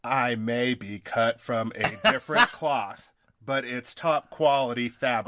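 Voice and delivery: slow, deliberate tagline read, no accent; careful slowed reading, accent dropped; slow plain reading. Shown as a demonstration. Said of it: "that was not a top quality reading".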